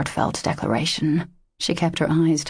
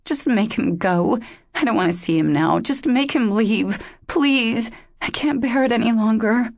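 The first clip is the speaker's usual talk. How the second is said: higher-pitched, pained pleading; aching with tears; 6 semitones higher than usual. A narrator's reading. Injured woman begs her friends to send Dash away, tearful. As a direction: beg tearfully for relief. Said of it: "her voice aching with tears".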